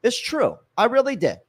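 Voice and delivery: laughing insistence that he really quit school; excited insisting. Real, not acted. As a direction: jump up in pitch and insist.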